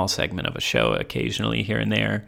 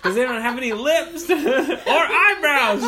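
much higher, slow, laughing through it; giggling, can barely get it out; 5 dB louder, slower than usual. Real, not acted. Cracking up with a friend about skeletons, laughing.

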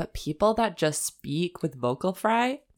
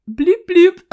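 silly bloop sound effect; 12 semitones higher, 12 dB louder than usual. Retold or imitated. Imitating curly hair sound.

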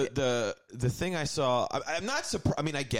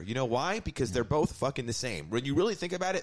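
ordinary talk, nothing marked

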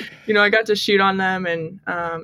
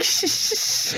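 unvoiced whispery shushing, acted out; imitated whispering; 13 semitones higher, 4 dB quieter, slower than usual. Player and teammate giggling over French words in the corner, imitated whispering. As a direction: mimic secretive whispers in quick hushes. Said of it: "whispering in the corner and be like".

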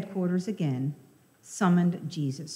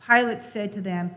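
ordinary talk, nothing marked

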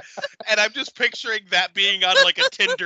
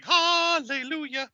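mock hallelujah; 7 semitones higher, slower than usual. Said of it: "on my end it was like".

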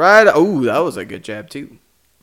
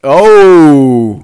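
loud, higher drawn-out "oh"; excited reaction; 4 semitones higher, 9 dB louder than usual. Real, not acted. Reacting to a straight right in the fight, real excited shout. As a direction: burst out as the punch lands.

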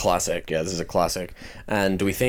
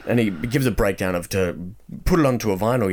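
normal talking voice

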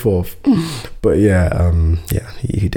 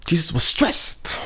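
mock breathy excuse; 8 semitones higher than usual. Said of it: "He was like".

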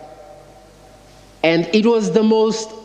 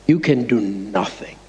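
low, quiet menace; demon voice; 9 semitones lower, 3 dB quieter than usual. Retold or imitated. Demon in the girl to the pastor, low understated menace.

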